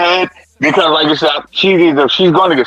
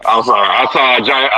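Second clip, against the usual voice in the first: ordinary talk, nothing marked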